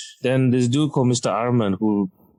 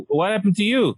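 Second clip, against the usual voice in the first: startled orderly; 9 semitones higher than usual. Retold or imitated. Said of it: "It's like".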